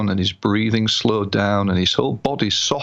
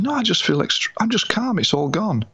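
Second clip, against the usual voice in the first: elated; 10 semitones higher than usual. Retold or imitated. Relieved client.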